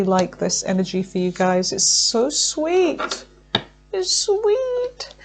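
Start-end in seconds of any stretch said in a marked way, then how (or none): 1.7-5.1 much higher, slow cooing voice; high sing-song delight; sweet coo. Real, not acted. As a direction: coo it like something adorable. Cooing over the sweet cards.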